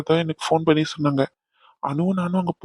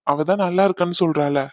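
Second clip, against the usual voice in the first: ordinary delivery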